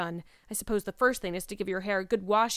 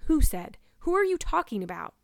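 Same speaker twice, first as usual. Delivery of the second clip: louder, higher interrupting question; sharp demand; 8 semitones higher, 6 dB louder than usual. A narrator's reading. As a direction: cut in and demand an answer.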